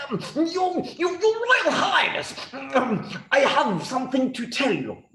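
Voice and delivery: high, stammering nervous minister voice. Acted.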